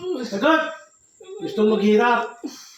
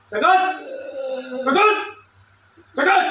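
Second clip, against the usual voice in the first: demanding shout; 4 semitones higher than usual. Acted. Demanding the spirit answer.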